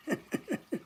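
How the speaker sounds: quiet, breath-only laugh; soft chuckle. Real, not acted. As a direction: chuckle softly to yourself. Amused by a chat comment.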